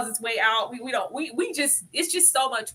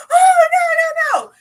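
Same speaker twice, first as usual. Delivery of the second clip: mock crying shout, much higher and louder; melodramatic wail; 11 dB louder, slower than usual. Mocking Tabitha's melodramatic scene, hammed-up crying shout. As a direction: ham up a theatrical sobbing wail. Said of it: "her little melodramatic".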